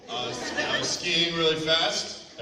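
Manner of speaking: ordinary delivery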